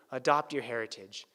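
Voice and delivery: normal talking voice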